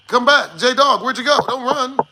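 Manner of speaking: shouting after someone leaving; calling out. Real, not acted. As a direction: call him back loudly. Calling after departed chatter.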